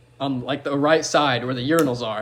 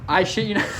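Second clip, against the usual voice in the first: usual speaking voice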